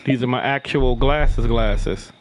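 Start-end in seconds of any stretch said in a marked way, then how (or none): none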